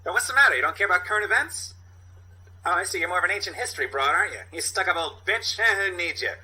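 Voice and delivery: high, fast, whiny street hustler; Steve Buscemi impression. Sasha the newsstand hustler snapping at a snobby woman, high and fast. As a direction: pitch it up and rattle it off like a pushy newspaper hawker. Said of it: "I have a good Steve Buscemi impersonation".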